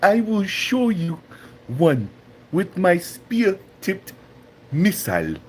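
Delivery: hammed-up African accent, high and halting; mock African accent, dramatic pauses between phrases; Chaba. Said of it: "he's like".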